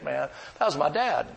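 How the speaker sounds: usual speaking voice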